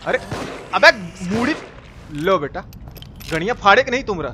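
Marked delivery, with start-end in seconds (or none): none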